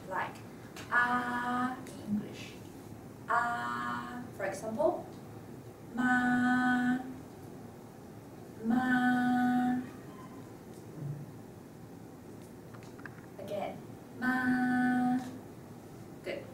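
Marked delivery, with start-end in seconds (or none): 0.9-1.8 "ah" held long on a level pitch; high stable tone. Shown as a demonstration. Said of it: "It's a high and stable pitch". 3.3-4.2 "Ah" held long on one level note; high stable tone. Shown as a demonstration. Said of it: "It's a high and stable pitch". 5.9-15.2 "ma" held long, perfectly level; high stable tone. Shown as a demonstration. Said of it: "It's a high and stable pitch".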